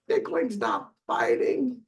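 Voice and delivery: mock a cross small child insisting; lightly played toddler complaint; angry little boy. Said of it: "He'd get really angry".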